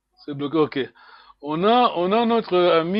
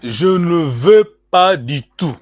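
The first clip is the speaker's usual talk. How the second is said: phrase dictée lentement et plus fort; répète la phrase pour qu'on la saisisse; lent et articulé; 9 dB louder, slower than usual. Shown as a demonstration. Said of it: "Quelle est la phrase". (phrase répétée lentement pour l'élève)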